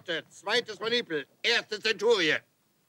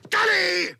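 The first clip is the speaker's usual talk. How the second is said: barked command; 10 semitones higher, 3 dB louder than usual. Acted. Drill sergeant barking orders.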